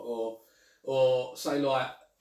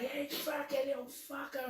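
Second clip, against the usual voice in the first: swearing parent; 10 semitones higher, 8 dB quieter, slower than usual. Retold or imitated. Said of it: "if they're going".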